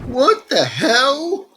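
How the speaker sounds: high, slow stunned cry; shocked disbelief. Real, not acted. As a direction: let the shock stretch the words out. Shocked by creepy smile.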